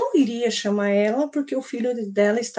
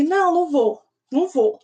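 mock yell, higher at first; retold shouting refusal; 5 semitones higher than usual. Boy refusing the activity to his teacher, mild mock shouting. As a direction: imitate a child's yelled refusal, mildly. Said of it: "ele falava gritando".

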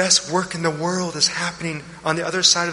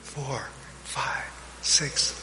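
slow breath counting; 2 semitones lower than usual. Shown as a demonstration. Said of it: "Try it with me".

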